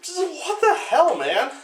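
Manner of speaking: high-pitched, drawn-out incredulous outburst; baffled disbelief. Real, not acted. Reviewer reacting to the absurd product placement, baffled disbelief. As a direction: stretch it out high, utterly baffled.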